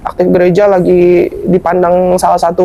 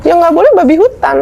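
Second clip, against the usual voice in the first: higher-pitched mock rebuttal; retold pushback, pitched up in self-defence; defensive protest; 7 semitones higher than usual. Believer defending himself in a retold debate, defensive protest.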